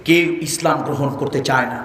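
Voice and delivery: ordinary talk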